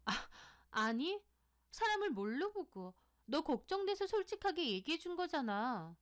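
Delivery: high-pitched, offended protest; indignant anger. A narrator's reading. Sohee defends herself to her accusing friend, indignantly angry. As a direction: protest angrily, pitch jumping up. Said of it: "소희는 화까지 내더라고요".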